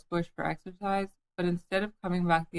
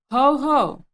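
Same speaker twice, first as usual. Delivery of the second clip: loud, high, would-be fierce shout; 8 semitones higher, 10 dB louder than usual. A narrator's reading. Timid Piglet trying to scare a Heffalump, loud shout. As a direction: puff up and bellow as bravely as possible. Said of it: "as fiercely as he could".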